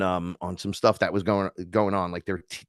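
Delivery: usual speaking voice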